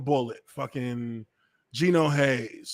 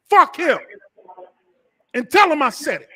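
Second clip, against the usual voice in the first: high, loud mock fury; defiant outburst; 9 semitones higher, 7 dB louder than usual. Host replays his answer to his friend, defiant.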